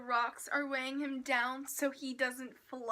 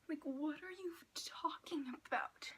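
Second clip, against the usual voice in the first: whisper; 8 dB quieter than usual. Real, not acted. Confused whispered aside.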